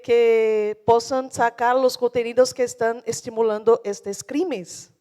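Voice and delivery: normal talking voice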